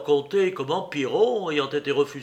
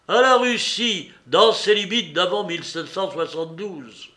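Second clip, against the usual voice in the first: loud, raised, declaratory toast; strong voice; 4 semitones higher, 6 dB louder than usual. A narrator's reading. Pan Wróblewski raises his glass, proclaiming loudly. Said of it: "dit d'une voix forte".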